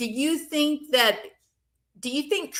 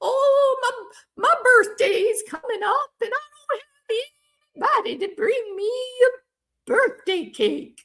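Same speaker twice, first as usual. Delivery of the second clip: much higher, wheedling sob-story voice; fake-pitiful grifter plea, pitched way up; put-on whiny voice. Grifter angling for a birthday cake, high wheedling voice. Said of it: "I don't know why I sound like".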